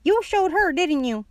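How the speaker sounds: put-on high old-lady call; grandma yelling. A narrator's reading. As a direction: cheer a child on as a grandma. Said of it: "she yelled".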